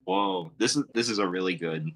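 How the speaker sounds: plain reading voice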